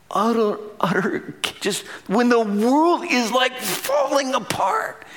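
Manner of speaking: preacher's voice rising to a cry; rising intensity. Real, not acted. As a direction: build it up as the world falls apart. Preaching about crazy chaos.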